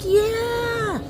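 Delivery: high, gleeful yell from the men; excited cheer. Acted. The five men cheering over the fierce bull. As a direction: cry it out as if you've won a prize. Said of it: "They're like".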